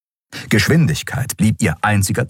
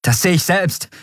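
Endriel calls out, raised pitch; called out; 11 semitones higher than usual. A narrator's reading. Pilot calls out under pressure, raised. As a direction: snap it back at the crew. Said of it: "rief Endriel".

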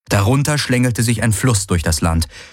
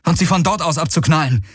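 higher-pitched urgent instruction; called out; 7 semitones higher than usual. A narrator's reading. Hero tells companion to shoot back, called out. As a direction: keep calling the order. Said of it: "rief Galen".

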